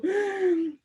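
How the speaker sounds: high, dramatic gasp; exaggerated intake of breath; mock shocked gasp. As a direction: gasp in pretend dismay. Acting out shock at being misunderstood.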